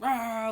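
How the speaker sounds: sudden loud high "Rah!" burst; playful imitated shout; mock Joker roar. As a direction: bark it out like a spooked clown. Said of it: "he's like".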